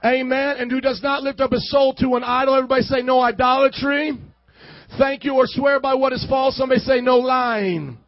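booming scripture reading; preaching. Acted. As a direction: preach it loud to the church.

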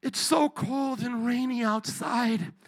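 a timid knock, mock pitiful plea; pleading meek Jesus. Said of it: "knocking at your door going".

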